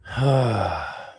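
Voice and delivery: long, falling, voiced sigh; exasperated sigh. Real, not acted. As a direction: sigh heavily at the penalty. Penalty flag against his team.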